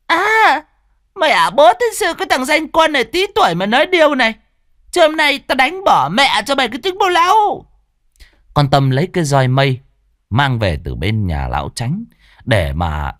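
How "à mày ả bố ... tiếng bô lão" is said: very high, loud fast tirade; furious shriek. A narrator's reading. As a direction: shriek curses at him. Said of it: "Giọng con Tâm cứ rít lên".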